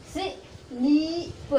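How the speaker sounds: स्लीप with the ee stretched very long; स्लीप sung out slowly with a long ee; long vowel. Shown as a demonstration. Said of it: "थोड़ा लंबा उच्चारण करके".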